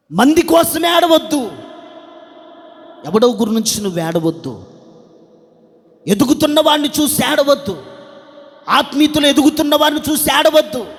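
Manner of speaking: high, loud chant-like string of commands; full-force preaching. Real, not acted. Preacher forbids crying over others' growth, high forceful preaching.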